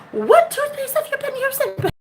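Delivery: very high squeaky-pitched question; mock amazement. Dentist amazed by the patient's teeth, high mock amazement. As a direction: shoot way up high, as if astonished. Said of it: "he's like".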